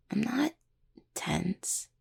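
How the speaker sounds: soft, hesitant denial fading out; uneasy denial. Acted. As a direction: trail off into a near whisper. Uneasily denies being tense.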